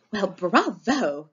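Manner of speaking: slow gushing showy praise. Acted. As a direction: gush like a thrilled host. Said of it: "gushes Effie Trinket".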